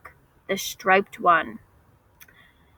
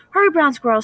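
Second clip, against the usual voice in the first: high, louder urgent call; 6 semitones higher, 5 dB louder, faster than usual. A narrator's reading. Grandmother calling the squirrel to run home, loud and high. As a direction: call him home in a hurry. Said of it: "she called".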